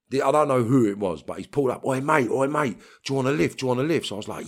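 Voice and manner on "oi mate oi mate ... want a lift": loud, high call across the street. Retold or imitated. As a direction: shout to him from the car window. Driver calling out from car.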